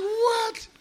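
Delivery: very high, loud mock disbelief; astonished squeal. Customer amazed by the Uber Cadillac, squealing. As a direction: shriek it, hammed-up amazement.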